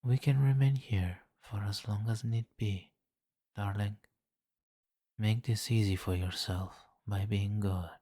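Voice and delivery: ordinary talk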